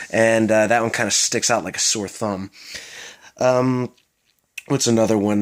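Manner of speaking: ordinary talk, nothing marked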